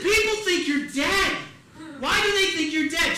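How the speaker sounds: high, loud exasperated disbelief; re-enacted agitated phone shout; incredulous outburst. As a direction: demand it as a worked-up son. Retold agitated call with mother.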